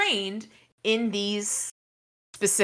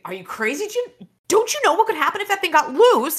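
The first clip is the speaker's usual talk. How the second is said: high-pitched, incredulous outburst; incredulous scolding; 10 semitones higher than usual. Acted. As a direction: scold them as if they're out of their mind. Villain scolds hero in alarm.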